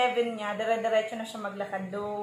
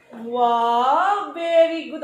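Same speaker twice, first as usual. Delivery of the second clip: excited praise; 3 semitones higher, 8 dB louder, much slower than usual. Real, not acted. Praising baby. (masiglang papuri sa anak)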